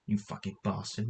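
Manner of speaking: ordinary delivery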